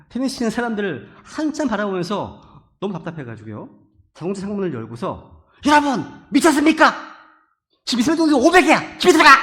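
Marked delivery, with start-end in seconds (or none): 5.7-9.3 loud, high yell out a window; imagined shouting. Pretend. Preacher at tennis players in smog, imagined yelling. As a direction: holler fast and exasperated at far-off players. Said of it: "외치고 싶었어요".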